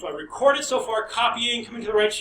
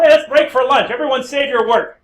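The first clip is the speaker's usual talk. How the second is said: loud announcement to the room; calling out; 4 semitones higher, 11 dB louder than usual. Retold or imitated. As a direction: call out to the whole class. Calling class to lunch.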